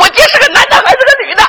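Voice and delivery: high, rapid shouted questioning; angry interrogation. Acted. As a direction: fire the question in a frantic shout. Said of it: "我当时我就恼了". (恼怒地尖声追问)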